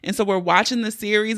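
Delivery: usual speaking voice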